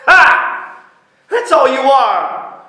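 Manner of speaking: loud, high scornful laugh; mock him loudly; loud jeering; loud mockery. Loud mocking cry.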